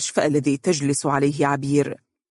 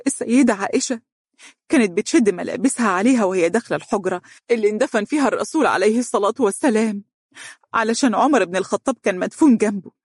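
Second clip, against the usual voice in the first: put-on higher, tearful but insistent young woman; voice broken from crying; 6 semitones higher than usual. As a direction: argue through lingering tears. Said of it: "قالت بصوت متقطع من أثر البكاء". (بصوت متقطع من أثر البكاء)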